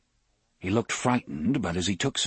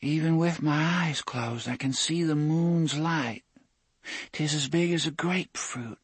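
dreaming voice; 8 semitones higher than usual. A narrator's reading. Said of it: "A dreaming voice, faint but clear".

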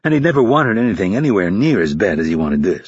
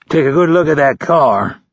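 higher-pitched sour remark; 6 semitones higher, 4 dB louder than usual. A narrator's reading. Instructor to new recruit about the departing car, sour. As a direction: say it with sour contempt. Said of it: "Hurley said sourly".

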